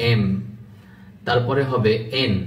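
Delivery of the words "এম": "এম" with both lips closed; careful M. Shown as a demonstration. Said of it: "দুইটা ঠোঁট মিশবে". (দুই ঠোঁট মিলিয়ে "এম")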